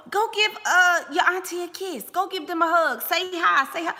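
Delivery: high, loud coaxing voice; pushy relative. Retold or imitated. Mother mimics a relative pushing kids to hug auntie, high and loud. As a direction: prod the child to hug the relatives. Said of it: "you know".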